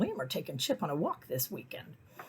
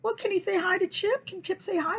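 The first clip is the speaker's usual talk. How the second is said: much higher, sing-song ask; eager asking. Retold or imitated. Narrator asking if her dog can greet, high and eager. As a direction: ask sweetly for a play date. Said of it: "I'm like".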